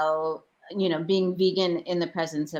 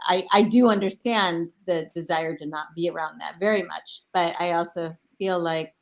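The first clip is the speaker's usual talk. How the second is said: normal talking voice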